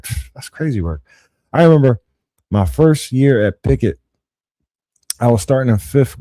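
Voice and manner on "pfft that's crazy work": quiet, scoffing reaction with a pfft; dismissive pfft. Retold or imitated. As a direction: brush it off under your breath. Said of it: "That's why I reacted that way".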